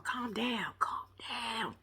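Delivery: soft whispered soothing; calming whisper. Retold or imitated. Martell whispering to calm his uncle. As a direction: hush him down gently.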